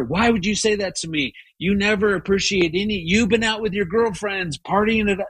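imagined quarrel. Retold or imitated. Husband imagines snapping back at wife, mock indignant. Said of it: "got into a whole thing with her".

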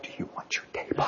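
a soft breathy-free whisper, barely voiced; hushed timid ask about the table; whispered question. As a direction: whisper it politely like a shy stranger. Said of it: "the people at my table are like".

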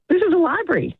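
indignant librarian. Acted. Librarian setting a confused customer straight, indignant. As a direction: pitch it up, scandalised by the request.

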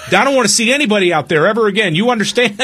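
high, fast mock scolding; imitating scolding mom. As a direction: rattle it off as the angry mother. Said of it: "yelling at him".

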